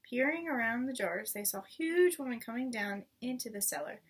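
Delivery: ordinary delivery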